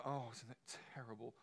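mock gloomy sigh, soft and low; a hint of pitying sigh; mock gloom. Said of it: "We don't all go".

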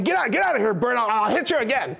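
angry shout. Retold or imitated. George yelling at Bert to get out. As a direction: shout him away in a hammed-up rage. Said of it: "he's saying".

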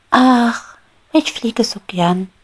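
high, loud longing exclamation; eager sigh. Acted. Little bee Maya tells the beetle she loves flying, high and eager.

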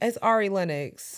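soft, slow "Ari" as AR-ee; AR-ee pronunciation. Shown as a demonstration. Cohost answers which name is right, AR-ee pronunciation of "[name]". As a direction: say "Ari" with an open "ah", softly. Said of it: "I go by [name]".

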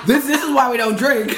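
high giggling punchline; laughing. Real, not acted. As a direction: laugh the joke out. Joking after the spill.

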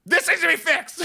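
high, loud complaint yelled out; mock scream. Frustrated customer yelling at the lowest worker, mock scream. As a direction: scream it like a fed-up customer, hammed up. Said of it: "fucking scream at and be like".

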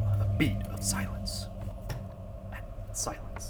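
hushed, whispered beat; whispered narration. A narrator's reading. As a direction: let it drop to a whisper.

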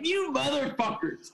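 high, slow exasperated curse; hammed-up indignant swear; mock outrage. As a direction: curse at the ticket givers in mock fury. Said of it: "I was like".

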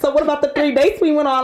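high, indignant mock complaint; salty. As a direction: whine like you've been ghosted. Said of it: "I'm gonna be salty".

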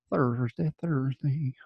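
put-on soft mystery accent, trailing into a whisper; made-up Thursday accent. Astrologer playing with the day's name, hushed made-up accent. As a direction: let the silly accent fade to a whisper. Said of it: "I don't know what, what accent that is".

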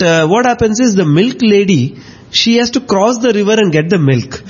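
ordinary talk

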